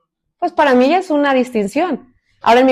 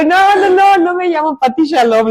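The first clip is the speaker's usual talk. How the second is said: retold laughing protest, high and loud; high, loud protest just after a laugh; amused denial; 7 semitones higher, 5 dB louder than usual. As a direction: re-enact an amused, insistent correction. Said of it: "Y yo me río y le digo".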